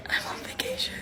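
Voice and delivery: tiny, whispered protest; mock whimpered complaint. Telling the doctor about her ruined holiday, whispered mock complaint. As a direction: squeak it out under your breath, almost no voice. Retold tearful complaint, barely voiced.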